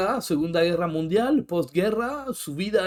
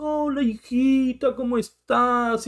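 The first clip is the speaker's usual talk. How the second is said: higher, slow, honeyed hello to a daughter; sweet loving greeting; 6 semitones higher, slower than usual. Shown as a demonstration. Father showing how he greets his daughter, sweet and slow. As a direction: coo it like a doting father. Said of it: "no llega a ese nivel de melosidad amorosa".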